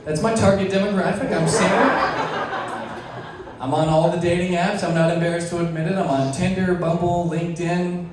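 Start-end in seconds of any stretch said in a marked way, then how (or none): none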